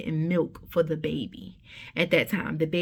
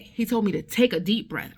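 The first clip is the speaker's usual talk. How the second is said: ordinary talk, nothing marked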